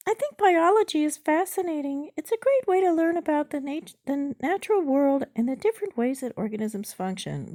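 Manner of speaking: high, loud bot character; chatbot voice. Acted. Chatbot sidetracks drug question into biology, high bot voice. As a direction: pitch the bot's speech way up.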